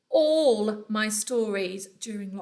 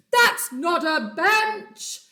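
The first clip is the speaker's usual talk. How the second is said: louder, slow elephant bellow; 6 semitones higher, 8 dB louder, slower than usual. Acted. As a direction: boom it out to warn the children. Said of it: "he bellowed".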